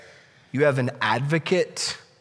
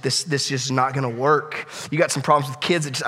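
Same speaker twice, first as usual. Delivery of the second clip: ordinary talk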